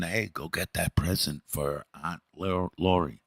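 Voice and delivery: soft, innocent-sounding murmur; a quiet cover-up request; a parent leaning in to murmur a harmless errand, softly; mock whisper. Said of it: "so it looks like you're just whispering something".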